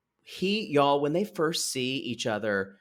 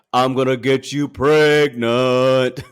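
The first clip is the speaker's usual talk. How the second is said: mock macho line; 8 dB louder, slower than usual. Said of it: "he's like".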